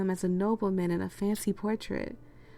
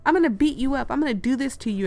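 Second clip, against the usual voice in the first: higher, louder mock threat; imitated yelling girl; 6 semitones higher, 7 dB louder, faster than usual. Reader imitating a girl who is yelling at her, mock yell. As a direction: act out a girl's yelled threat. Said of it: "a girl is yelling at me".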